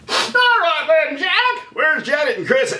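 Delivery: very high-pitched sitcom character voice; Mister Furley impression. Shown as a demonstration. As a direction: imitate a comic character far above your own pitch. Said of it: "I did a Mister Furley".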